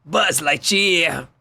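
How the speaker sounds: lightly voiced cartoon villain kid; a hint of Sid from Toy Story. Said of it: "foi o Sid do Toy Story, que era um vilão".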